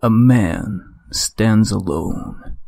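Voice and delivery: slow, dramatic trailer narrator; movie trailer voice. Acted. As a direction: intone it like an epic film trailer. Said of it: "the speaking with a movie voice edition".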